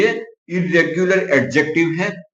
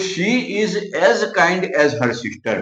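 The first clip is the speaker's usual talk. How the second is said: slow dictation; much slower than usual. Shown as a demonstration. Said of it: "sentence लिया है".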